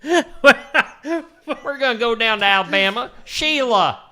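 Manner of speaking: loud, high, slow Alabama drawl; mock Alabama shout; Alabama accent. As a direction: holler in a hammed-up Alabama accent. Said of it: "I need to be doing an Alabama accent".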